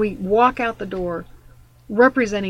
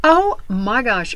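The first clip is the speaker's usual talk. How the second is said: ordinary delivery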